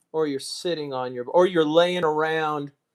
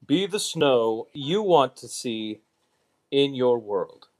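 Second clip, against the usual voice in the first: lower, measured mock Obama speech cadence; a hint of a presidential address, lowered; Obama impression; 5 semitones lower than usual. Streamer jokingly thanking viewers in lower, mock Obama speech voice. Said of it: "Barack Obama Snow".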